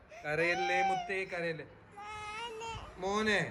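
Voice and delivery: calm her gently, almost under the breath; soothing hush. Real, not acted. Father soothing crying baby.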